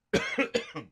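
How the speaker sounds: sudden hacking cough; choking cough. Real, not acted. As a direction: cough as food goes down wrong. Food went down wrong pipe.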